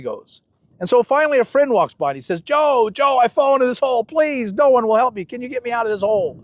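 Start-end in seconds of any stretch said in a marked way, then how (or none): 2.4-6.4 calling out. Retold or imitated. Man in hole calls his friend.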